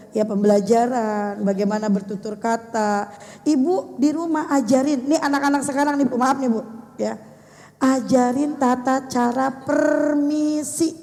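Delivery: ordinary talk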